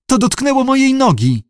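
very high, frightened little whine; whimpering. A narrator's reading. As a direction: whimper like a scared pampered darling. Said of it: "kwilił koteczek". (wysokie, przestraszone kwilenie)

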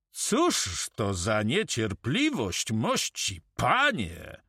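slow, drawn-out scolding; mock reproach. Karma chides the impatient caller at the door, slow and mock-reproachful. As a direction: stretch the words with swooping pitch.

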